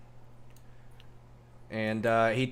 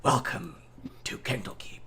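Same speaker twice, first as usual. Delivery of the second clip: hushed welcome. Pretend. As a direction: let it sink into a hush. Repeats the welcome softly after reveal.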